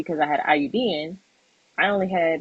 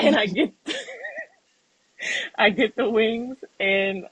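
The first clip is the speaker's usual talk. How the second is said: laughing; 6 semitones higher than usual. Real, not acted. Laughing at her own craving.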